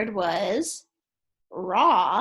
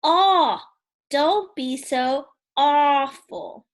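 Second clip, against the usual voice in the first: loud cry of complaint for the class to copy; louder cry of dismay; 4 semitones higher, 6 dB louder, slower than usual. Shown as a demonstration. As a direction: model the cry for kids to repeat. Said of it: "Let's say that together".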